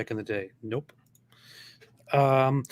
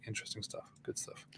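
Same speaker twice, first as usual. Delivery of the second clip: whispering; 19 dB quieter, faster than usual. Real, not acted. Whispered aside.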